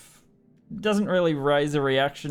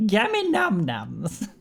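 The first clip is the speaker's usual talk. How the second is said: put-on slow, drawn-out old lady voice; 5 semitones higher, slower than usual. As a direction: say it like a sweet old granny. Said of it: "she's got a new voice already".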